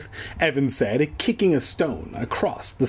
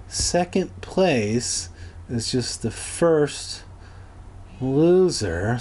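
slow, weighty, like a grand truth; mock-profound pronouncement; much slower than usual. Shown as a demonstration. Joking about second place, said slowly like a solemn motto. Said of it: "slow pronouncement, mock-profound".

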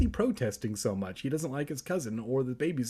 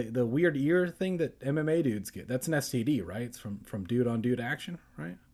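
usual speaking voice

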